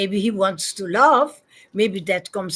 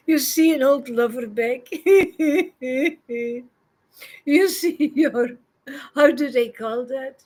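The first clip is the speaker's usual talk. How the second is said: higher, tickled delivery broken by laughter; giggling; 4 semitones higher than usual. Real, not acted. Devotee likening Krishna to an old sweetheart, giggling. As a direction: giggle between the phrases.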